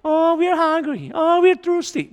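high whining mock complaint; whiny complainers. Preacher mocks the people whining to Moses. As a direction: whine like a hungry crowd.

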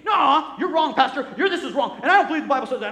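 imitating an upset member arguing with the pastor; mock upset protest. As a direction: play the offended churchgoer, hammed up and laughing. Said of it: "we get all upset ... act just like Cain".

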